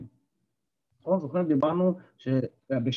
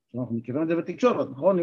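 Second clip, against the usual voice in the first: ordinary talk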